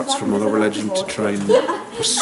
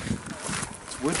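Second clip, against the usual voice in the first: softly whispered, rising on wood; hushed whisper with a lift on wood; whispered aside; 5 semitones higher, 11 dB quieter than usual. Real, not acted. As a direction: whisper it so nobody in the church hears. Hushed in church.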